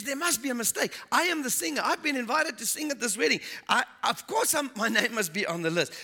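indignant protest. Acted. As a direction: insist there must be a mistake.

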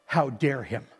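usual speaking voice

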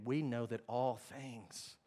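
soft, low, reverent reading; quiet scripture recital. Acted. Preacher reciting Romans to the church, soft and low. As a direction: read it softly as a promise. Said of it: "Romans eight twenty-eight".

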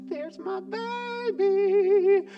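high, loud and very slow; gushing coo. Retold or imitated. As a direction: stretch it out adoringly. Sees boyfriend finally arrive.

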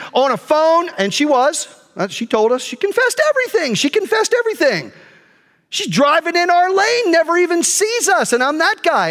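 high-pitched indignant complaint; retold fuming anger. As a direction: replay a self-righteous rant about a driver. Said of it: "I was that fuming mad".

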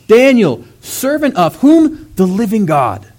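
loud, high, slow cry calling down into the pit; anguished call. Acted. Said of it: "called out to Daniel in an anguished voice".

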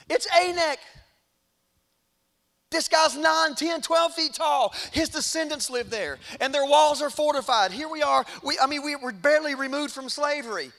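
high, loud, panicky objection; alarmed protest. Retold or imitated. Fearful spies warning the people about giants, acted alarm. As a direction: act out spies crying danger.

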